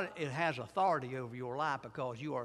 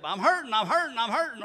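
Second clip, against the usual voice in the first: louder, higher, whining I'm hurting; whiny complaint; 5 semitones higher, 7 dB louder than usual. Acted. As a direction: cry out like a hurt child. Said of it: "I keep popping right back".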